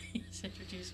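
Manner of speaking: soft, breathy echo of the trailer line; whisper. Pretend. Host echoes the co-host in a whisper. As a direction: repeat it in a hush.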